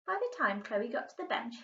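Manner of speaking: plain reading voice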